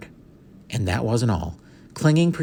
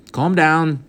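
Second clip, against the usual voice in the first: curt; 7 semitones higher, 6 dB louder than usual. A narrator's reading. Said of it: "said Patrick shortly".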